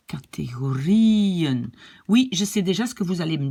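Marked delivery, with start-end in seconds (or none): 0.1-1.7 "Categorieën" slow, long stressed RIE, falling -en; accent sur le I. Shown as a demonstration. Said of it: "l'accent est sur le I". (« Categorieën » lent, RIE allongé et accentué)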